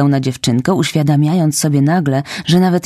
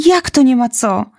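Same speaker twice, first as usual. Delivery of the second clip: tearful protest; 9 semitones higher than usual. Acted.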